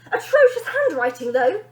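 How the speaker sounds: loud, high scornful remark; mock his scrawl; bossy sneer. Bonnie scolds father's note.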